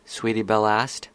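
ordinary delivery, nothing marked

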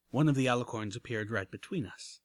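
plain reading voice

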